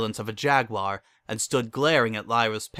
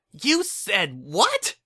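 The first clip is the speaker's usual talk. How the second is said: high, slow, outraged demand; a daughter confronts her parents in a cafe; angry demand; 12 semitones higher, much slower than usual. Acted. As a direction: demand it in disbelief. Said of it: "demanded Lyra".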